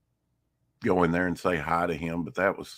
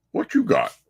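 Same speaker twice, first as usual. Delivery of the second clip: higher voice for the cat; high pet-talk voice; 6 semitones higher, 5 dB louder than usual. Acted. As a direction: coo it up at the cat. Talking to the cat Abigail.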